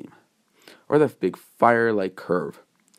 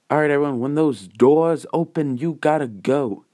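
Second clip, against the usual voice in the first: exam announcer; 6 semitones higher than usual. Acted. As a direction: send the examinees off in a raised voice. Nezu announcing the exam start.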